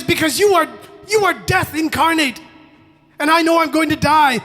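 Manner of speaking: high, loud, hammed-up pleading; terrified begging. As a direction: act out begging for your life. Hunter begs for his life.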